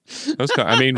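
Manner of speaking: ordinary delivery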